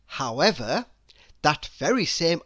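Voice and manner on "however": ordinary delivery, nothing marked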